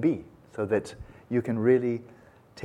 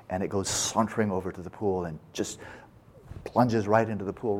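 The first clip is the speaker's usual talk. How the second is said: normal talking voice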